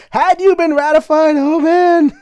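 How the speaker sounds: high, loud mock dismay; mock lament. Player teasing a failed state, high loud mock dismay. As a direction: wail at the board in playful disbelief.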